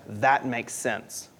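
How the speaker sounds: slow, low and soft; low, unhurried acceptance; matter-of-fact agreement. Acted. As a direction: quietly concede it, taking your time. Acting out an easy agreement.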